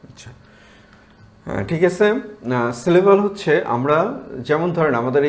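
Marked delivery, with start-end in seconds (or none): none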